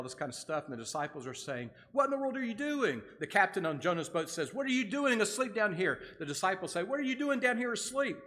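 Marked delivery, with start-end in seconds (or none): 1.9-3.0 high-pitched, acted astonished question; exasperated, raised-pitch challenge; incredulous demand. Disciples confront the sleeper, acted. 4.5-6.0 high-pitched, acted scolding question; exasperated, raised-pitch challenge; incredulous captain. Captain confronts the sleeping Jonah. 6.8-8.2 high-pitched, acted astonished question; exasperated, raised-pitch challenge; incredulous demand. Disciples confront the sleeper, acted.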